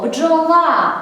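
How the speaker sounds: «бджола» with ла held long; stress on final syllable. Shown as a demonstration. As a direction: call the word «бджола», stretching its last vowel. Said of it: "Як же правильно вимовляти?".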